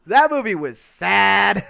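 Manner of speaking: slow, high, loud mock-sad repeat; hammed-up tearful echo; mock weepy echo. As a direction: drag it out like you're about to cry. Echoes friend's line in a put-on voice.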